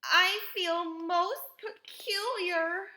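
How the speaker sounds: high, loud, slow wail; alarmed girl, pitched high; gasped. A narrator's reading. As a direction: draw it out high, as if unwell. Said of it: "gasped Violet".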